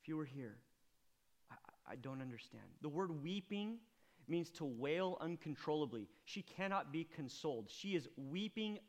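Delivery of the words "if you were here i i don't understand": broken murmur. Acted. Mary's broken whisper.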